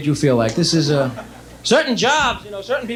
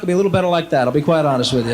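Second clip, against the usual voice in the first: usual speaking voice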